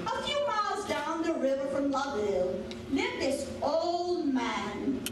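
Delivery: ordinary delivery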